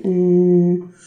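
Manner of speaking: long hummed "mmm"; demonstrated "mm" filler. Shown as a demonstration. Teacher corrects the student's filler with a long hummed mm. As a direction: hold a slow closed-mouth thinking hum. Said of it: "لا، تقول".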